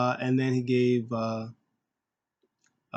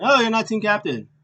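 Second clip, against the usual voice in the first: much higher and louder taunt; mocking shout; 9 semitones higher, 8 dB louder than usual. Teammates shouting down AJ's claim to be captain, mocking yell. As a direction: yell it back at him in mockery.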